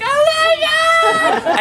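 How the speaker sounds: very high, loud, drawn-out squeal; mock shriek. Other Timbit girls seeing the monkey, mock shrieking. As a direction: squeal like a crowd of amazed coworkers. Other workers crowd round, amazed.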